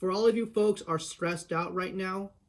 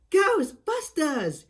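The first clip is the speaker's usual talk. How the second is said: mock slogan shout; 11 semitones higher, 6 dB louder, much slower than usual. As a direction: belt it out like the movie jingle. Shouting Ghostbusters slogan answer.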